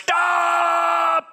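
sudden high, loud yell of alarm; a parent's piercing warning cry; shouted stop. Pretend. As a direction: shout it to stop the child at the red light. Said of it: "schreist du".

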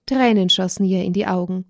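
usual speaking voice

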